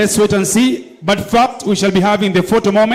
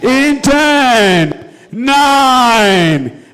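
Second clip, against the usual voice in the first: building countdown shout; 2 semitones higher, much slower than usual. Real, not acted. Countdown to winner announcement.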